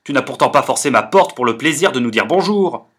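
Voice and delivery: beaucoup plus fort et plus aigu, en colère; se fâchant. Acted. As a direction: engueule l'ami qui lui fait perdre son temps. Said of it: "se fâchant". (Forestier qui se fâche et hausse la voix)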